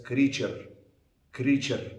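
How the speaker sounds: "creature" with an Arabic-style R; Arabic accent. Shown as a demonstration. Teacher showing students the accent, Arabic-accented "creature". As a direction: say "creature" as an Arabic speaker would. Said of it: "people with the Arabic accent may say as".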